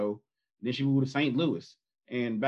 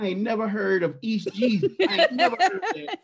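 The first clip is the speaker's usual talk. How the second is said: laughing disbelief; 5 semitones higher than usual. Retold or imitated. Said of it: "She's like".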